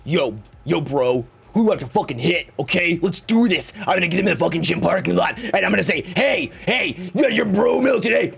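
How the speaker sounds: lightly voiced hyped gym bro; a hint of a pumped-up bro buddy. Friend hyping you up for a gym fight, mild bro voice. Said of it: "a friend to come up and be like".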